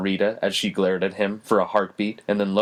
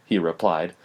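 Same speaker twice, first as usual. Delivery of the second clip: ordinary delivery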